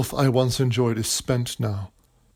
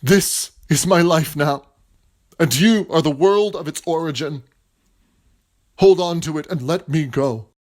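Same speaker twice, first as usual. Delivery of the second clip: raised anguished voice; 5 semitones higher, 6 dB louder than usual. Acted. William parting in anguish.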